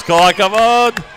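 excited. Real, not acted. Greeting marchers on air.

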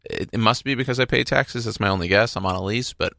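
usual speaking voice

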